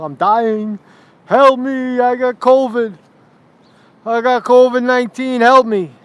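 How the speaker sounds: high, loud mock cries for help; mock panic. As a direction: play a hammed-up dying patient begging for help. Acts a panicked patient arriving.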